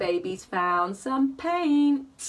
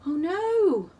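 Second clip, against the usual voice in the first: usual speaking voice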